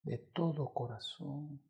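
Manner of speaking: very quiet, slow de todo corazón; whispered final echo; soft fading repeat. Acted. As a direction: let it die away. Fading repetition.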